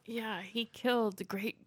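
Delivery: usual speaking voice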